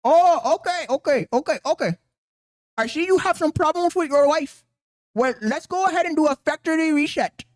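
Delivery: put-on high-pitched Indian-accented call center agent; mock customer service agent. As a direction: play an obliging helpline worker in a put-on accent. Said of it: "Thank you for calling wife customer service".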